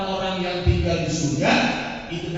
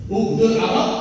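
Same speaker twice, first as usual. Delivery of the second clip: raised, louder hadith phrase; a punchy, raised recitation; emphatic repeat; 5 semitones higher, 7 dB louder than usual. Shown as a demonstration. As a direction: call it out with force.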